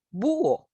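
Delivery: BÚHO with a high, stressed Ú, then a separate O; vowels separated. Shown as a demonstration. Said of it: "y se separan. Por ejemplo".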